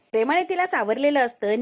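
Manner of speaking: usual speaking voice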